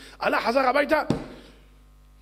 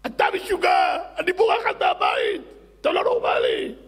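high shrieking yell, much louder; furious wife; 12 semitones higher, 6 dB louder than usual. Acted. Wife to crazy husband, shrieking furiously. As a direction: scream it at him, fed up.

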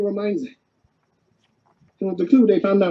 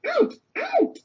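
squeaky retold shout; 5 dB quieter than usual. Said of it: "And Shaggy's saying".

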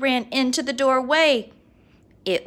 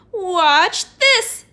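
heroic boast; 6 semitones higher, 7 dB louder than usual. Acted. Superhero boast.

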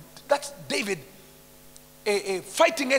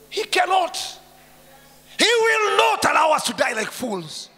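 fiery preaching; 7 semitones higher, 7 dB louder than usual. Acted. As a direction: declare it with authority. Preacher at full force.

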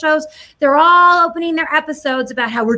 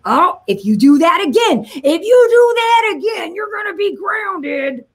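higher, slow, shouted threat of grounding; scolding dad shout; 5 semitones higher, slower than usual. Retold or imitated. Dad warning his gum-spitting kid, slow high shout. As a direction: yell at the kid like a fed-up father. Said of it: "like a dad scolding a child".

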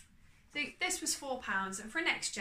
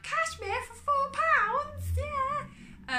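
very high, slow, thrilled squeal; excited squeal; 8 dB louder, much slower than usual. Retold or imitated. At a four-pound cashmere find, squealing with delight. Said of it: "and I was like".

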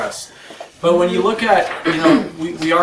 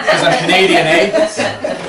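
put-on jokey Canadian accent; 3 semitones higher, 5 dB louder than usual. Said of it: "do have the accent".

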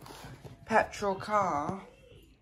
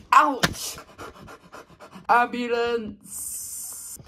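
higher, drawn-out cry for help; mock pained call; 4 semitones higher, 4 dB louder, much slower than usual. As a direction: call out in fake agony. Mock injury, calling for help.

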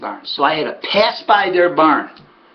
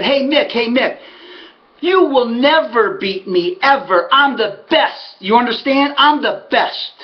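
raised, emphatic retold taunt; pumped-up boast; 7 semitones higher than usual. Winner shouts his boast at his rival, pumped-up retelling. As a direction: crow over a beaten rival. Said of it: "I was so pumped up".

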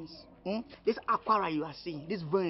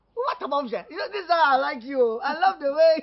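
delighted cheer; 9 semitones higher, 7 dB louder than usual. Acted. Delighted once food is promised.

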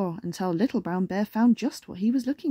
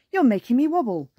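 raised, wobbly-bear story voice; calling out; 3 semitones higher, 4 dB louder than usual. A narrator's reading. Bear calls up to rabbit, mildly alarmed. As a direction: call it out in mild alarm. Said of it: "called Little Brown Bear".

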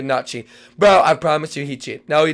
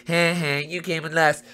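mocking laugh; much slower than usual. Real, not acted.

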